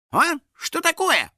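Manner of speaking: high, startled questioning; surprised. A narrator's reading. House spirit suddenly caged, surprised. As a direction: squeak it in bewilderment. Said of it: "удивился домовой".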